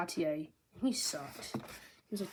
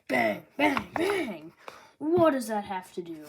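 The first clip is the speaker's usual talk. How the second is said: mock angry outburst; 3 semitones higher, 6 dB louder, slower than usual. [name] shooting Luffy's poster, mock angry outburst. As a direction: fire off each bang.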